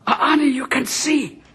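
dawning amazement, not yet full voice; stunned realisation. Acted. Husband realises his blind wife can see, stunned.

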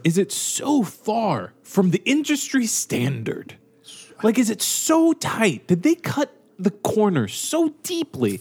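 slow, high-pitched rhetorical questioning; drawn-out, emphatic incredulity; incredulous. Real, not acted. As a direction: stretch out each emphatic word in disbelief. Rhetorical questions about airline seats.

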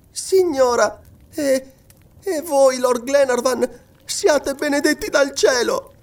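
put-on high, halting, heartfelt young voice; grateful girl. A narrator's reading. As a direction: bless them fervently, pitched high. Girl overcome with gratitude.

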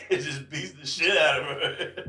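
ordinary delivery

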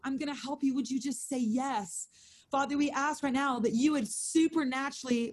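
intercessory prayer to the Lord; earnest prayer. Acted. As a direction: pray it out over the room. Leads the prayer.

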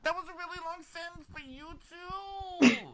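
way up high, teasing squeak; mocking high voice. Friend teasing her about a long sentence, high squeaky mock voice. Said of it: "She's like".

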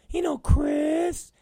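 high, eager falsetto impression; mock him pitching a dumb idea in a squeaky voice; Brandon. Coworker pitching a wrestler to his boss, high mocking falsetto. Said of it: "Brandon has a lot of stupid".

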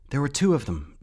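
high, loud urgent report; shaken. A narrator's reading. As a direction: blurt it out, still startled. Boy speaks after the shock.

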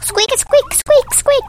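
high squeaky squeaks; squeaky noise. Pretend.

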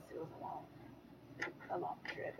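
breathy, tired trailing off; tired sigh. Real, not acted. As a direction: let it fade out wearily. Weary trailing off.

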